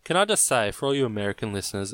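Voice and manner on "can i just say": ordinary talk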